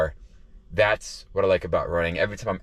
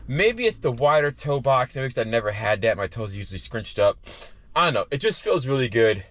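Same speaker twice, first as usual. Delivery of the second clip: ordinary talk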